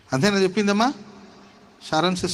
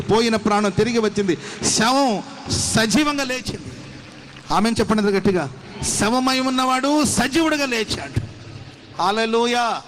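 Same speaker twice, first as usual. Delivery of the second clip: preaching at full force; 5 semitones higher than usual. Acted. Pastor rousing the congregation to clap, full-force preaching. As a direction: lift the crowd, voice climbing higher.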